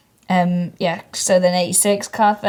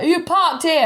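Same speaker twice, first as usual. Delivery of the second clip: grumpy neighbour; 9 semitones higher than usual. Retold or imitated.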